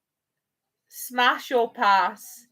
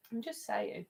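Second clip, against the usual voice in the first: same words again, much softer, half to herself; quiet repeat; 15 dB quieter than usual. Real, not acted. Repeating herself to friends who ignored her, softly. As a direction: let I'm just saying fall away into a soft aside. Repeats the remark after no reply.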